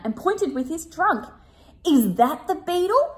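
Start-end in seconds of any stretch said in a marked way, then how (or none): 1.9-3.1 triumphant trumpeting. Acted. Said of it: "he trumpeted triumphantly".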